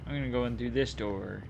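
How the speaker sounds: normal talking voice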